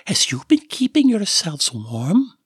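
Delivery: mother cooing over her pet snake; sing-song motherly. Acted. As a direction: coo to the snake like a doting mother. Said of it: "she said in a sing-song motherly tone".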